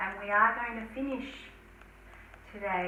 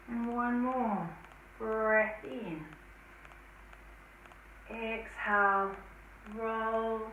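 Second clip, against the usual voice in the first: slow, soft guided breathing; calm breath cues, trailing to whisper; guided meditation; 7 dB quieter, slower than usual. Acted.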